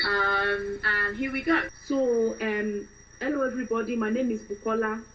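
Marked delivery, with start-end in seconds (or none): 1.9-5.0 Nigerian English accent; fresh Nigerian woman. Shown as a demonstration. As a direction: introduce yourself as a newly arrived Nigerian woman. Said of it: "start off with a fresh Nigerian woman".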